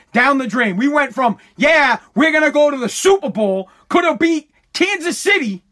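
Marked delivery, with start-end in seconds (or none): 1.6-5.6 shouted, higher, imitating cocky fans; a fan bragging about the Super Bowl, shouted mock cheer; mock hype. Loud mock excitement. Mocking the team's earlier hype.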